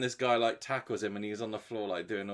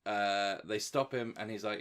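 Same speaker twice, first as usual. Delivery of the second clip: ordinary talk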